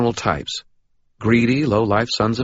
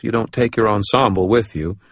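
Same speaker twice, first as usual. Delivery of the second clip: ordinary delivery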